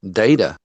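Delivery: data said as "DAY-tuh"; long A in the first syllable of data; data rhymes with "later"; DAY-tuh pronunciation. Shown as a demonstration. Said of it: "A and E is still both vowels".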